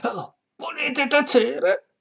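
high, slow, urgent shushing; alarmed hush. Acted. Priest hushes his housekeeper in fright, high urgent hush. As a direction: silence her, alarmed and pleading. Don Abbondio hushes Perpetua.

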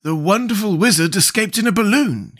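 a straw man pleased with his brains; Scarecrow voice. Acted.

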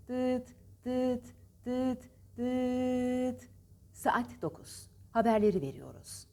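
beeps then a formal news read; old TRT announcer. Retold or imitated. Teacher imitating a TRT news announcer. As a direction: announce the hour like a state newsreader. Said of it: "TRT'de çok güzel anons yapardı spikerler".

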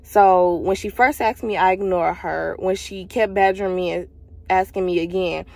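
ordinary talk, nothing marked

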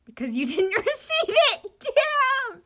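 high, loud, laughing punchline; triumphant punchline. Acted. A teammate shouting the punchline of his joke, loud and high. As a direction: crow the joke's payoff.

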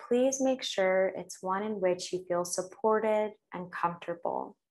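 ordinary talk

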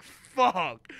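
loud curse. Retold or imitated. Son realizing mom saw him, loud drawn-out curse. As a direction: let the curse burst out in horror.

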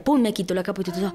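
ordinary delivery

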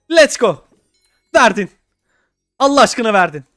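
loud, thrilled cry of disbelief; real excited shout at the egg; excited yell. Real, not acted. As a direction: shout it like the prize just dropped. Egg finally hatching in game.

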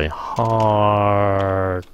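heart with a very long "haaar" before the t; drawn-out vowel. Shown as a demonstration. Teacher shows heart is lengthened, drawn-out vowel. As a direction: stretch the vowel of heart right out. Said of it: "heart kéo dài ra".

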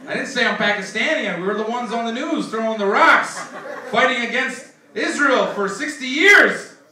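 mock outraged retort. Angry retort quoted in bit.

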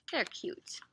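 plain reading voice

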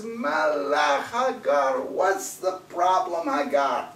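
high, slow call to Hagar; an angel's slow summoning call. A narrator's reading. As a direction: call her name from above, drawn out.

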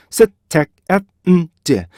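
셋, 택, 앳, 음, 제 as five separate beats; staccato syllable-by-syllable demo; syllables split apart. Shown as a demonstration. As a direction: break it into five evenly timed syllables. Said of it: "똑같은 리듬을 여러분들 분리하시면".

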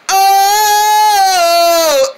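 long, high held oh; pretend wail. Character hit by the guitar, long high wail. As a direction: draw out a high cartoon cry.

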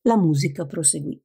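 ordinary delivery, nothing marked

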